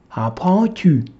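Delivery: ordinary delivery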